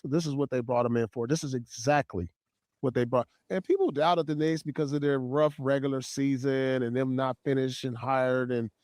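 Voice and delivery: ordinary talk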